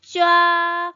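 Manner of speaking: 쫙 held long on one high, level pitch; first tone. Shown as a demonstration. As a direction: draw 쫙 out high and even, like underlining. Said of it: "일성은 왼쪽에서 오른쪽으로 밑줄 긋듯이". (쫙을 높고 평평하게 길게, 일성으로)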